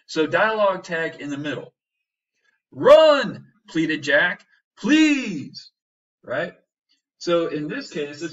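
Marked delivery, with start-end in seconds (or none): 2.7-3.5 loud, high, urgent plea; pleading shout. Shown as a demonstration. Teacher acting Jack begging someone to flee, urgent shout. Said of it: "like screaming it at him". 4.8-5.7 high, loud begging; urgent plea. Shown as a demonstration. Teacher voicing Jack's desperate plea, loud and high.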